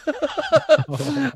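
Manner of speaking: high, delighted burst of laughter. Real, not acted. Laughing at mum's remark.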